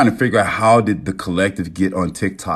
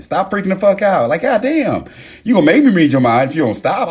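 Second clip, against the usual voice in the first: mock scolding; 11 semitones higher, 4 dB louder than usual. Mock scolding chat for panicking.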